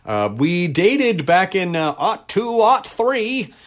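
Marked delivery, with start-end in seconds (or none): 2.0-3.5 lightly voiced old-timey speaker, rising higher. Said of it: "you have to say it with the old-timey voice".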